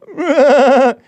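high loud wookiee yowl; a hammed-up growling wookiee cry; a strained howl, pushed high; Chewbacca roar. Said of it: "That's your Chewbacca".

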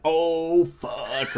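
drawn-out "oh", dropping away; comic oh-no realisation, stretched out; retold dawning dread. Retold realisation she is the sister.

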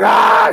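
loud, high imitated scream; retold scream. Girlfriend cuffed to bed screams for neighbors, imitated. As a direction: yell out, mimicking her scream. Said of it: "She's screaming for the neighbors".

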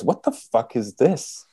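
Norm's humorous disbelief. Retold or imitated. Comedian handed a badly rolled joint, humorous disbelief. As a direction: stretch out a comic, baffled complaint. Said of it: "in that wonderful Norm sense of humor".

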